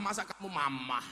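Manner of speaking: usual speaking voice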